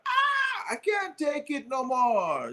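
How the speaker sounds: put-on high, slow Jamaican accent with a yelped "Ah". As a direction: imitate a light Jamaican accent. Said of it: "In his little Jamaican accent".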